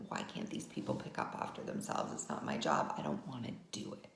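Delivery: low, breathy resentful grumble under the breath; irritated grumbling. Retold or imitated. Inner voice of fed-up mom grumbling about family's mess, low resentful mutter. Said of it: "irritated, annoyed, frustrated".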